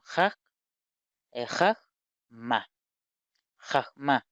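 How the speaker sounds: "jaj, ma" in separated syllables; "jaj... ma", A in both syllables; shown reading. Shown as a demonstration. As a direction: say "jaj" with rasped j at both ends, then "ma". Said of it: "Ahí diría".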